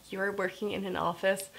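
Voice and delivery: hushed mock-shocked whisper; whispered quote. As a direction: whisper the question as if it were scandalous. Quoting friends' shocked question.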